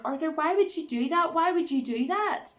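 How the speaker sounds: high-pitched mock distress; retold panicky protest; acted alarm. Acts friend reacting to the killing.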